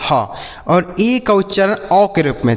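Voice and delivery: normal talking voice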